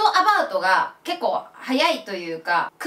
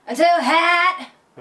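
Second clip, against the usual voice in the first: rough and stretched out, slow and louder; a bit rough; 5 semitones higher, 4 dB louder, much slower than usual. Shown as a demonstration. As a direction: drag it out with rough force. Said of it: "ちょっと、乱暴な". (乱暴にゆっくり押し出して)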